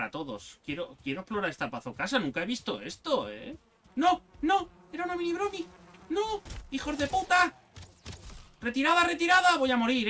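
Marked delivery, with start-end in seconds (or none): 4.0-7.6 panicked yelling. Real, not acted. Ambushed in a fight. 8.6-10.1 loud, high call to retreat; shouting orders over battle. Real, not acted. Fleeing a losing battle.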